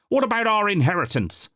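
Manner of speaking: very high, loud outcry; a cousin demanding their inheritance; shouted demand. A narrator's reading. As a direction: shout from the crowd. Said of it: "shouted another".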